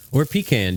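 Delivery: PECAN said as PEE-can; alternate pronunciation. Shown as a demonstration. Host offering co-hosts the other pronunciation, PEE-can for "pecan". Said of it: "Do you say pecan or pecan?".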